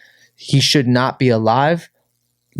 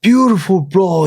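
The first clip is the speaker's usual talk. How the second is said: hyped coach impression; 11 semitones higher, slower than usual. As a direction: shout praise like an overexcited coach. Coach Felipe praising him loudly.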